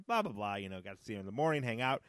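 normal talking voice

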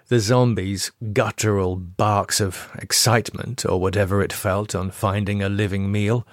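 ordinary delivery, nothing marked

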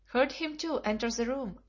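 ordinary delivery, nothing marked

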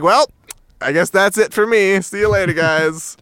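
high, resigned goodbye; resigned farewell. Retold or imitated. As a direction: shrug it off like a doomed hunter. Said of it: "he's like".

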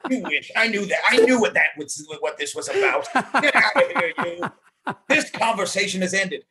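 high, loud laughing mock outrage. Real, not acted. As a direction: tease while laughing. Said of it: "laughing teasing".

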